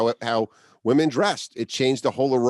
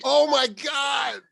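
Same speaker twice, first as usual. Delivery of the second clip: drawn-out, much higher cry of amazement; astonished exclamation; 12 semitones higher, much slower than usual. Real, not acted. Listener reacting to the T-shirt headband, amazed. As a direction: let it burst out slow and high. Guest stunned by the headband story.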